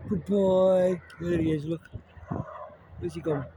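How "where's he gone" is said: usual speaking voice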